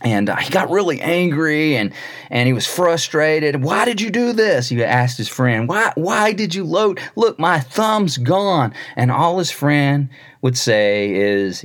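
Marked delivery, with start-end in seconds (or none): 3.6-4.7 high-pitched exasperated demand; pitched-up angry outburst; angry king. Retold or imitated. Angry king shouting at friend. 5.7-8.7 high, slow, distressed complaint; pitched-up upset king. Retold or imitated. King complains of lost thumb.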